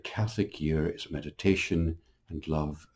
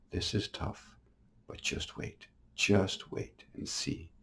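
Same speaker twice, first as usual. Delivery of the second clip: soft, slow whispered comfort; tender whisper; 3 semitones higher, 6 dB quieter, slower than usual. Retold or imitated. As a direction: hush it like soothing someone dying. Jesus comforting a dying woman.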